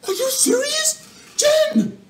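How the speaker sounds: can't believe the donation; shocked disbelief. Real, not acted. Reacting to huge donation.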